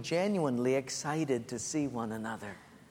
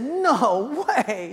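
higher, louder mock disbelief; 9 semitones higher, 7 dB louder than usual. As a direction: act out disbelief at the news. Disbelieving reply in retold dialogue.

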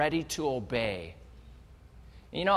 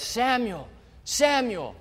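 voice of God calling; 5 semitones higher than usual. Acted.

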